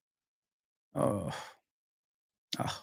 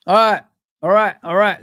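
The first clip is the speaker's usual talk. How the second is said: Matthew McConaughey; 4 semitones higher, 7 dB louder than usual. Retold or imitated. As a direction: ham up the laid-back Texan "all right". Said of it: "Like Matthew McConaughey".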